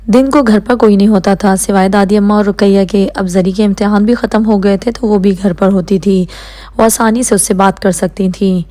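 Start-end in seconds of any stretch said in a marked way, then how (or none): none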